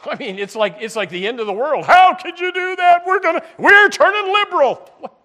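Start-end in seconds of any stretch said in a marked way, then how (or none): 1.8-5.2 much higher and louder, whining outrage; hammed-up shrieking complaint; mock outraged churchgoer. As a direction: play the scandalized old member who fears change. Mocking outraged traditionalist churchgoers.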